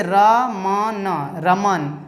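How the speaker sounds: रमन said very slowly, each sound held; very slow demo of रमन; slow, drawn out. Shown as a demonstration. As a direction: stretch out रमन to show the long र and म. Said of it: "जो धीरे धीरे होता है".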